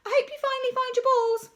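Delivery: high, loud cheeky call. A narrator's reading. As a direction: call it after him in a high voice. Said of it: "called out Rebel".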